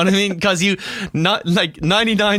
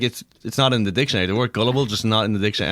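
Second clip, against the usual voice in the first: insistent quote; 7 semitones lower, faster than usual. Retold or imitated. Date insisting gullible isn't in the dictionary, fast. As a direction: rattle it off fast and sure. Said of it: "She's like".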